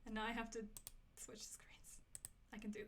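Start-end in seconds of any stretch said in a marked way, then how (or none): 1.2-2.0 whisper. Real, not acted. Quiet aside to self.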